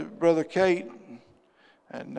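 normal talking voice

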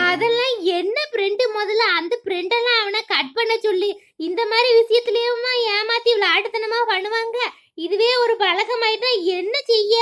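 சந்தேகத்துடன் கேட்கும் பக்கத்து வீட்டுப் பெண், தாழ்ந்த குரலில்; doubting neighbour woman. Acted. Neighbour character voiced. (மகனின் நண்பர்களைப் பற்றி சந்தேகிக்கும் பெண், தாழ்ந்த குரலில்)

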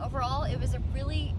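talking while bouncing in the truck; on a bumpy road. Real, not acted. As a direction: voice jolted by road bumps. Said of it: "sorry for the bumps".

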